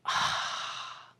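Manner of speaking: a soft stunned gasp, voiceless; a hushed, breathless gasp of shock; shocked breathy gasp. Retold or imitated. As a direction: gasp in dismay at the sight. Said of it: "is just like".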